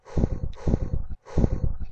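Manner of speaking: a long unvoiced shuddering breath; shaky tearful sigh. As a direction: sigh like holding back a sob. Breaks down over [name].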